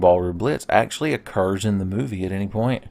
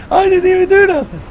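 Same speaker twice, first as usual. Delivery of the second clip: much higher mock whiny knight; squeaky, innocent defence; Solaire's protest; 7 dB louder than usual. Streamer voices ally Solaire after dying in lava, high mock whine. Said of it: "Solaire's like".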